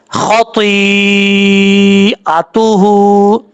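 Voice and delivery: very slow, drawn-out recitation; slow Quran recitation. Shown as a demonstration. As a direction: stretch each syllable like a reciter teaching it. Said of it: "tajweed demo of a word". (बहुत धीमी, खिंची हुई तिलावत)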